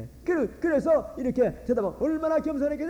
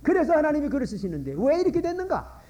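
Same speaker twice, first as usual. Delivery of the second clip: ordinary delivery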